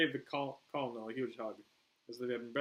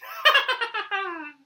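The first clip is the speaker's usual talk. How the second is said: high, loud manic laugh; Harley cackle; 16 dB louder than usual. A narrator's reading. Harley laughs at the soldiers, high cackle. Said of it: "And Harley laughed".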